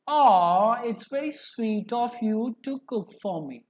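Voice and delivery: tender, touched thanks; loving. Shown as a demonstration. Teacher demos love to students: tender touched thanks. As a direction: thank them sweetly for the meal. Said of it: "I'm expressing my love".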